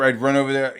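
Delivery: usual speaking voice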